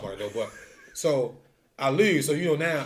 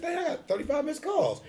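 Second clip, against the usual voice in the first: much higher, startled exclamation; mock shock; 14 semitones higher than usual. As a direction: sound caught off guard. Discovering missed calls, shocked.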